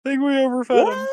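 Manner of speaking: high shouted echo of the line; shouted quote. Retold or imitated. Friend yells Bulk's line back at the podcast host, high and loud.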